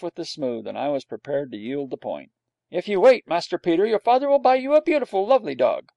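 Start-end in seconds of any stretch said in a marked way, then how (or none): 2.7-5.9 higher, louder wheedling nanny voice; coaxing nurse. A narrator's reading. Nurse coaxing the boy with a promise, wheedling. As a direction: sweet-talk the child out of it.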